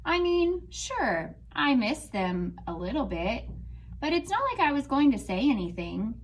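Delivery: expressive storybook reading; reading to children. Acted. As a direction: read it out like a picture book to a class. Teacher reads picture book aloud.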